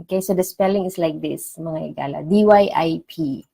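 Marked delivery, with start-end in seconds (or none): none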